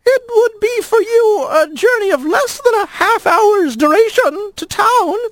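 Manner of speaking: very high, prim and formal; a nervous lizard hinting politely; faint lizard voice. A narrator's reading. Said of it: "then a faint".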